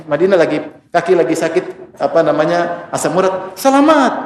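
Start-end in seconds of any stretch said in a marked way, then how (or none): 3.6-4.2 "Selamat" high, falling, as a prayer for the sick. Retold or imitated. A Medina local blessing someone with gout, mock kindly. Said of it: "Pasti mereka bilang gitu".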